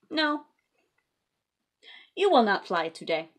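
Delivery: a hint of two storybook characters, firm; lightly voiced Piggy and Elephant. Reading to kids, lightly voiced Piggy refusing. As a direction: play the friends for little kids, a touch bossy.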